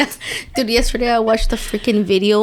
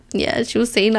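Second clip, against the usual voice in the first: ordinary talk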